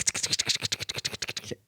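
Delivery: whispered clock ticks; soft mouth ticking sounds; ticking noise. Retold or imitated. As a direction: tick it off under your breath like a clock. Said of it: "is making my brain go tick".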